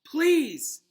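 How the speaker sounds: high, loud desperate please; shrill pleading cry; frantic plea. A narrator's reading. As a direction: beg in panic. Hermione frantic for help.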